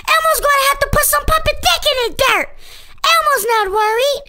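usual speaking voice

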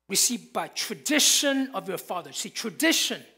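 ordinary talk, nothing marked

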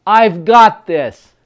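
loud, slow, emphatic affirmation; confident with feeling. Shown as a demonstration. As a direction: punch it out with conviction. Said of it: "with some feeling and with some confidence".

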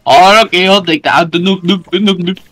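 mocking imitation. Player parroting a teammate's "I'll take that", mocking.

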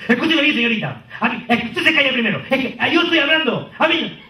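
high, pushy customer voice; rude customer. Retold or imitated. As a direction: talk down to her like an entitled client. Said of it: "clientes que dicen así".

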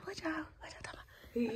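soft unvoiced whisper, quoting Mary; whispered imitation of Mary. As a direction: breathe the line out as Mary's quote. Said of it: "Mary's like".

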